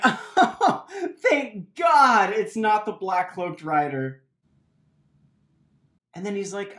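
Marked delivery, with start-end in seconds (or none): 0.0-4.2 laughing through the words with relief; nervous relieved laughter, slow. Acted. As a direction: giggle it out, half crying with relief. Relieved at the monster.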